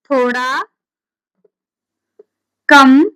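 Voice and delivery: loud, isolated words with long pauses; slow dictation. Real, not acted. Teacher giving a definition to write down, slow dictation. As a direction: dictate word by word, loudly. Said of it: "परिभाषा ऐसे लिखेंगे".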